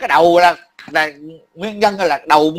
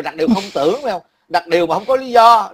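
ordinary talk, nothing marked

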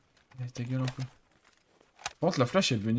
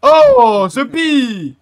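furious shout, much higher and louder; angry yell; 12 semitones higher, 11 dB louder than usual. Real, not acted. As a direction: scream at your teammate in rage. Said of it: "tu fais quoi là". (hurler de colère)

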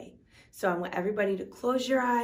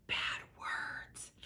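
hushed whisper; 14 dB quieter than usual. Real, not acted. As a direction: whisper the naughty part, slow. Teacher hushes naughty words.

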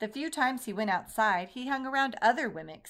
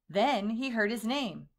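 plain reading voice

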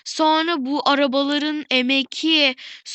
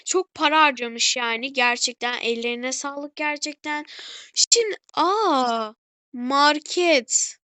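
ordinary talk, nothing marked

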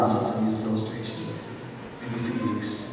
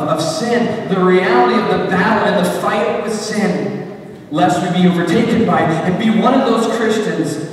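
raised, insistent pleading in repeated phrases; fervent public prayer; 5 semitones higher, 7 dB louder than usual. Real, not acted. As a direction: pray aloud with a preacher's force. Leads congregation in prayer.

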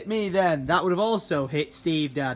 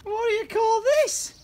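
squeaky, incredulous challenge; high-pitched indignation. Real, not acted. Owner catching his pet lazing about, high and indignant. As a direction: demand an explanation way up high.

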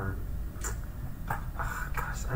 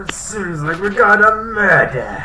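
loud mock detective; 7 semitones higher, 10 dB louder than usual. Echoes the detective line, louder.

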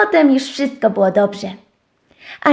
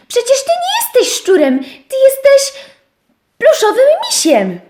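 dużo wyżej i głośniej; przejęta owieczka; 12 semitones higher, 4 dB louder than usual. Acted. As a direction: przekonuj wysoko i z zapałem. Owieczka przekonuje. (owieczka przekonuje szczura, wysoko i głośniej)

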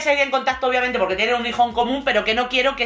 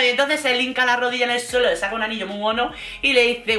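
usual speaking voice

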